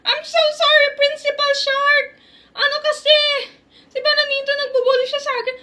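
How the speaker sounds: put-on high, pleading child voice; little kid apologizing to Principal Shark. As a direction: beg the principal's pardon like a small kid.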